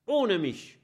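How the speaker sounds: defiant call. Acted. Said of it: "ruft er".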